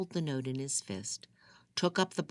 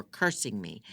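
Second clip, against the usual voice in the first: ordinary delivery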